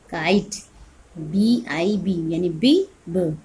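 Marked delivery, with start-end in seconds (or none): none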